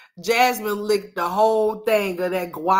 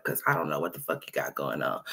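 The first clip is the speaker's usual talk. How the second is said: normal talking voice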